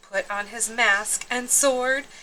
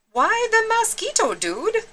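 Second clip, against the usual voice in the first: very high squeaky little voice; small dog, casual and high; tiny pup voice; 13 semitones higher than usual. Acted. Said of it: "asked Poquito Tito".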